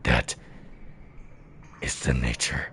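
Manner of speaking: deep, grave warrior speech; Dung Defender voice. Acted. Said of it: "very accurate voice-wise now".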